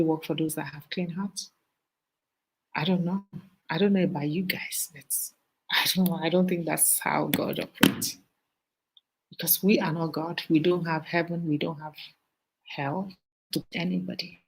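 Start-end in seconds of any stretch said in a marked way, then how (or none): none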